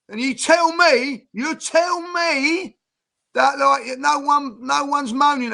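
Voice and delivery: rising, slow disbelief at the manager; incredulous outrage. Real, not acted. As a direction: spell it out slowly, high and incredulous. Angry rant at the manager.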